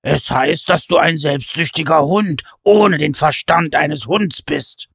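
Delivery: shouting back. A narrator's reading. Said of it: "brüllte Pen zurück".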